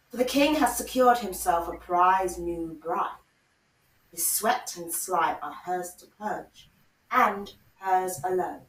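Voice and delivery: dark, measured storytelling voice; story narration. Acted. Reads the washerwoman scene.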